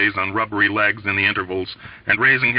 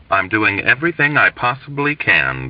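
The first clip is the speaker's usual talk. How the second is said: usual speaking voice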